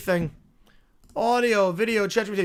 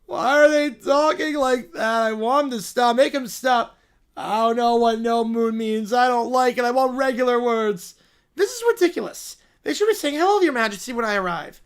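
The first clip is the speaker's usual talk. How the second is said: whiny spoiled royal; 3 semitones higher, 5 dB louder than usual. Acted. Spoiled character complains about the flight, whining. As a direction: whine like a pampered little royal who hates travel.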